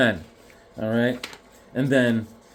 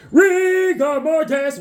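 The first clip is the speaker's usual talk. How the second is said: high, loud, drawn-out holler; hammed-up calling out; 13 semitones higher, 5 dB louder, slower than usual. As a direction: stretch it out like calling the dog. Hammed-up call after dog joke.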